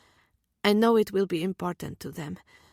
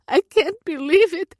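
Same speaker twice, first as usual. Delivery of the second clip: higher, louder, stunned and teary; overwhelmed disbelief; 7 semitones higher, 7 dB louder than usual. Acted. Mother repeating it on the phone, louder in amazement. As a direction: gasp it out in wonder. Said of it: "My mama keeps saying".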